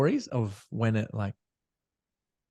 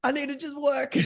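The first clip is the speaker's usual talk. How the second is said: very high cartoonish whine; mock someone desperate to hide in work; hammed-up squeaky protest; stressed-out workaholic. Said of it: "I'm so stressed out".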